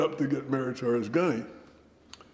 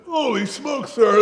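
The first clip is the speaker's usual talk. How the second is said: very high, loud exclamation; retold shock, pitched way up; startled awe; 12 dB louder, slower than usual. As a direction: blurt it out, flabbergasted. Retold shock at facing the general.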